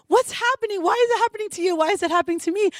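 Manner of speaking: high, bewildered cries; confused panic. Retold or imitated. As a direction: ask in retold alarm. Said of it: "we were just so confused".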